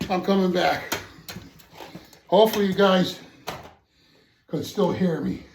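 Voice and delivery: loud call to the viewers on the way back; calling out loudly. Real, not acted. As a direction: raise your voice so they can hear you. Calling to viewers while walking back.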